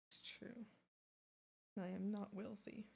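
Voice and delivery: soft unvoiced whisper; a breathy conspiratorial whisper to chat. Real, not acted. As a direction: whisper it like a secret aside. Whispered aside after joke.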